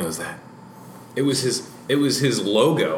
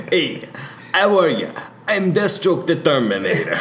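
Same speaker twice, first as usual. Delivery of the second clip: goofy celebrity voice; 7 semitones higher, 4 dB louder than usual. Retold or imitated. Goofy impression of actor.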